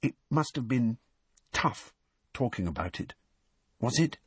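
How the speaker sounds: softly and hesitantly, with pauses; quiet, halting question; low voice. A narrator's reading. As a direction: keep the volume down, feeling your way. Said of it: "went on in a low voice".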